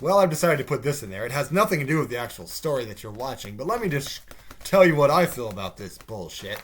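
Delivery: loud mock pompous announcement; punchy, self-important mock speech; mocking writers. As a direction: imitate a writer pushing an opinion. Mocks writers shoehorning opinions.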